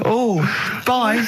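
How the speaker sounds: high, chirpy farewell; sketch goodbye. Acted. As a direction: sign off like the camp character. Comic sketch dialogue.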